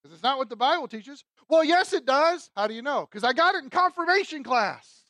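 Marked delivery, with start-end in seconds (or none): 1.5-2.5 loud, high, drawn-out mock comeback; insistent retort. Objector argues back at the preacher. 3.1-5.0 high-pitched mock self-justification; defensive justification. Objector justifies the belief.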